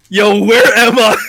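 loud, drawn-out mock confusion; dazed drunk waking. Acting friend waking up drunk.

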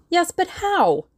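high, urgent cry; exclaimed question, pitched well up. A narrator's reading. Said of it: "cried Tuppence".

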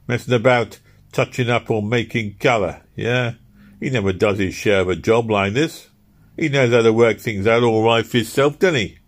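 lightly voiced labourer moaning about the cold; Philpot's voice.

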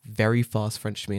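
ordinary talk, nothing marked

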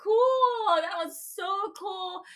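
high, breathless oh my gosh, so cool; amazed. Retold or imitated. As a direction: gush as if near tears of joy. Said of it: "and I'm just like".

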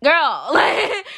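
half laugh, half wail; laughing exasperation. Real, not acted.

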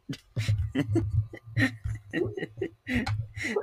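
laughing hard; high breathy laugh. Real, not acted. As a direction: burst of airy giggles. Laughs at own joke.

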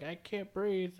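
wheezy panicked recruit. Retold or imitated. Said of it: "he's like".

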